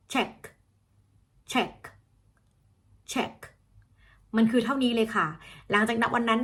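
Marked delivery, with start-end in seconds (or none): 0.1-3.3 "check" with a very short, crisp American CH. Shown as a demonstration. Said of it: "มันต้องสั้นมาก". (ออกเสียง ch ใน "check" ให้สั้นมาก)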